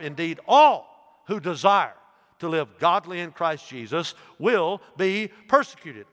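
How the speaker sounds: preacher drives the verse home; preaching at full force. Acted.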